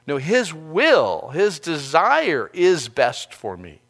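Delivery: slow, firm, every word weighted; slow emphatic stress. Shown as a demonstration. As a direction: stretch out "his" and "desire" with conviction. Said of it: "emphatic restated conviction".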